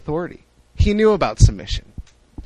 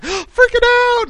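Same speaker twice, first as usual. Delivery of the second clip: mock gasp; 3 dB louder, slower than usual. Retelling Mary's panic.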